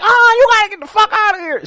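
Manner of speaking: very high, loud, shrieking order; mock grandma shouting. Grandson imitating grandmother throwing her out, loud shriek.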